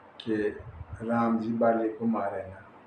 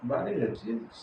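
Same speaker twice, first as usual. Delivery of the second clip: usual speaking voice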